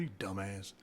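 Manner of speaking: muttered insult. Retold or imitated. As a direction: drop it under your breath. Retold insult to roommate.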